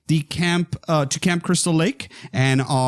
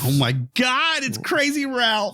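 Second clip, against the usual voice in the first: higher, hushed mock amazement; mock awe; 9 semitones higher than usual. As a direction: gasp at the name in fake awe.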